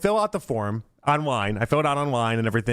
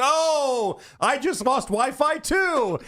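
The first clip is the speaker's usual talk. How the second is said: mock TSA agent; 10 semitones higher, much slower than usual. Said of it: "he goes".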